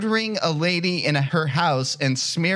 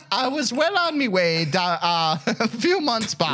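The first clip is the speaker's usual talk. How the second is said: higher mock Irish lilt, breaking into laughs; pseudo-Irish accent; 5 semitones higher than usual. Podcaster cracking up over a written question, mock Irish. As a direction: struggle through an Irish brogue. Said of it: "pseudo-Irish speech".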